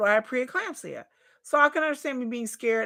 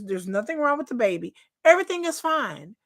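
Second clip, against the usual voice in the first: ordinary delivery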